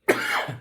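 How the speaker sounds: harsh coughing fit; choking cough. Real, not acted. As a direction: cough hard, something went down wrong. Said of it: "I'm choking".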